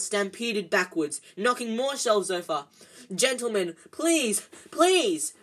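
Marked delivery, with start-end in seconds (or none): 3.1-5.3 rising, louder pleading cry. A narrator's reading. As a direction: beg them to stop, getting higher. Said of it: "cried the assistant".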